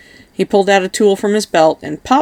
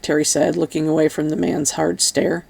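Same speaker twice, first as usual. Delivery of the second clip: ordinary delivery, nothing marked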